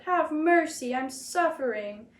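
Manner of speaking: high pleading wail, slow at the end; a suffering fox wailing for mercy. A narrator's reading. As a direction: beg with a drawn-out wail. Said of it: "the fox wailed".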